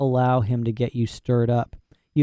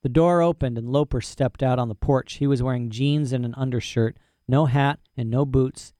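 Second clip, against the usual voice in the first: plain reading voice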